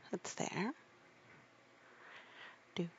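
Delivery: plain reading voice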